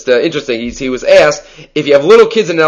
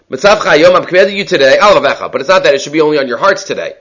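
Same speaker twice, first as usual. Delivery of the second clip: ordinary delivery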